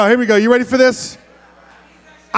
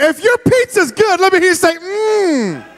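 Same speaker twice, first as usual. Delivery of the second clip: excited call-out; 9 semitones higher than usual. Real, not acted. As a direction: whip up the crowd. Rallying kids to respond.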